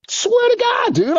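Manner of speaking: can't believe this guy; incredulous. Retold or imitated. Disbelief at stranger's approach.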